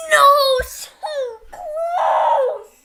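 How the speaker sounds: loud pained cry of frustration on close; frustrated cry. Real, not acted. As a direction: wail it out like you just barely missed. Just misses breaking the record.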